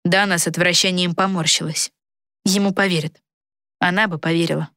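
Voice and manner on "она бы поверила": ordinary delivery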